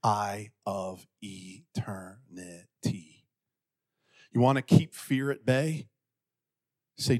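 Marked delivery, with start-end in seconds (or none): 0.0-3.2 hushed and drawn out, syllable by syllable; slow, solemn emphasis. Real, not acted. Preacher reminding church of eternity, slow and solemn. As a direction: drop low and soft, very slowly. Solemn close after the teen parody.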